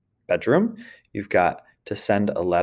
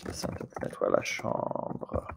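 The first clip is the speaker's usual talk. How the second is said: whispered while typing; 11 dB quieter than usual. Real, not acted. Typing answer, muttering.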